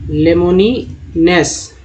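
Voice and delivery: लेमोनिनेस drawn out very slowly, each syllable clear; slow word demo. Shown as a demonstration. Teacher reading a word list aloud, very slow. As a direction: spell out लेमोनिनेस by sound, slowly. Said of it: "next देखिए".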